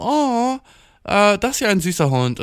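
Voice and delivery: high-pitched retold coo of delight; cooing at a dog. Storyteller replaying his remark to a girl about her dog, cooing. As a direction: imitate a gushing "oh" at something cute. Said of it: "ich war dann so".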